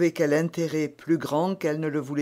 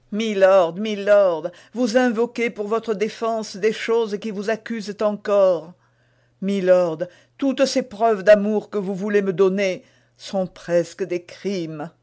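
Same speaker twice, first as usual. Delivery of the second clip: queen, gently reproachful, a little higher; Anne's reproach; 5 semitones higher, 5 dB louder than usual. A narrator's reading. Queen rebukes the duke's declaration, reproachful.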